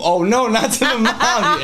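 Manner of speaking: ordinary delivery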